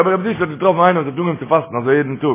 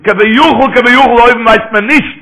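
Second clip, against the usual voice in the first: preaching shout; 6 semitones higher, 8 dB louder than usual. Real, not acted. Preacher raises voice on point.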